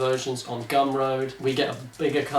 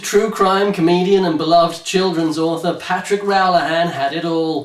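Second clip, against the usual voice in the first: dramatic blurb read; 2 semitones higher, 4 dB louder than usual. Acted. Host reading the back of the book to listeners, dramatic blurb voice. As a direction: sell it like a thriller blurb.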